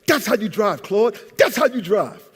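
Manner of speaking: excited praise for Claude after the meeting; triumphant cheer. Retold or imitated. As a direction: cheer your partner on. Cheering partner after the deal.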